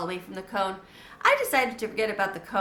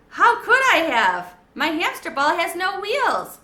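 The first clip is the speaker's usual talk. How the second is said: high, loud, slow mock outrage; indignant protest; 8 semitones higher, 8 dB louder than usual. A narrator's reading.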